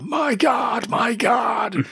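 high, drawn-out mock cry; hammed-up commentator shouting in disbelief; wrestling commentator. Fan imitates a WWE commentator, high mock shouting. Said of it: "he was like".

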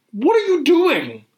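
very high, swooping disbelief; exasperated protest. Retold or imitated. Fan to the show's director, exasperated. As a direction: wail it at someone who has let you down.

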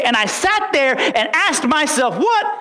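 slow, rising build to a high-pitched "What"; impassioned build. Retold or imitated. Preacher recalling asking himself what happened, impassioned build. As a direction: climb in pitch towards an astonished question.

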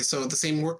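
normal talking voice

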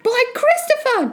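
put-on very high boyish exclamation; crying out. Jack hearing the giant eat, high shocked cry. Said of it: "Jack cried".

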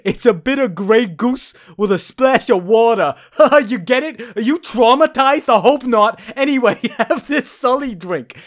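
hammed-up New York bartender, high and loud; a brash New York accent pushed up high and loud; bad New York accent. Bartender gushing to Sully, in a hammed-up New York accent. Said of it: "This is a terrible New York accent".

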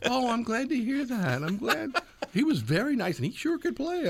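high, unhurried Southern drawl; imitated Southern accent, high-pitched. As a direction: draw it out high as a Southern lady. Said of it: "her southern, like, drawl kind of accent".

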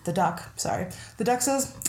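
ordinary delivery

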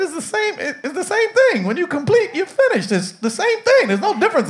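squeaky, exasperated high voice; high-pitched insistence; high mock protest. Pastor voices himself in joke.

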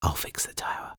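barely voiced whisper; quiet whisper. A narrator's reading. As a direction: whisper it under your breath. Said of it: "he heard a quiet".

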